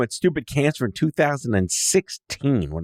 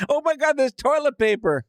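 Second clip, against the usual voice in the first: high-pitched, hammed-up shopper's delight; mock amazement; 13 semitones higher than usual. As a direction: exclaim it as a miraculous discovery. Acts shopper's joyful discovery.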